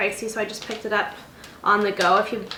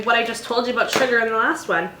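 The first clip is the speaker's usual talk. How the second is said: ordinary delivery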